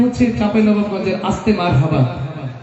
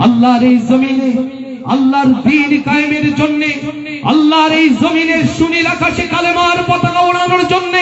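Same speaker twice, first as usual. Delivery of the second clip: building, climbing higher into a full-force sermon; fiery preaching; 10 semitones higher than usual. Acted. Preacher calling his brothers to raise the flag, building to a shout. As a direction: stir the faithful, rising to a roar.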